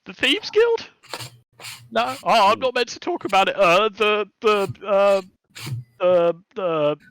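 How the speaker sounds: squeaky high panicked kobold voice; a terrified little thief babbling apologies; blubbering kobold. Acted. Kobold caught stealing carrots, panicked high squeak.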